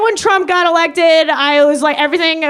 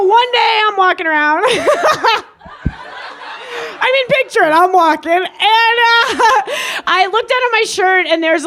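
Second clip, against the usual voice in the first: ordinary talk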